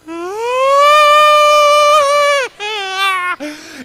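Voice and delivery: loud, high, wailing gasp; a howl of panic; crying gasp. Retold or imitated. Lost boy wailing for his dad.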